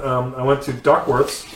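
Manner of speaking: usual speaking voice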